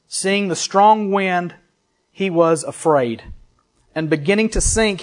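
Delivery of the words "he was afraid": usual speaking voice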